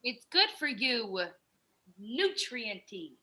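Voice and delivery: drawn out, persuasive; slow, coaxing. Acted. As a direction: sell the drink slowly. [name] sells the drink.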